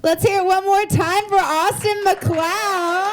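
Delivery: high, drawn-out announcer call; rally the crowd, stretching out the name; MC announcement. Real, not acted. MC calls for applause.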